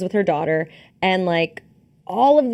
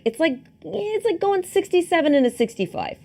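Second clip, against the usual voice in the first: high, put-on Boston accent; 10 semitones higher than usual. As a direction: do a movie Boston guy accent. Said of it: "How is my Boston accent?".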